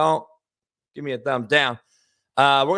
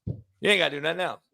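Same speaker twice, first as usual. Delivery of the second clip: ordinary talk, nothing marked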